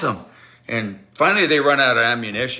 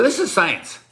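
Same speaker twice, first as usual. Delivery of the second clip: higher-pitched declaration to the class; mock announcement; 11 semitones higher, 3 dB louder than usual. Teller to the quieted class, high mock proclamation. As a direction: announce it high, as a playful put-on.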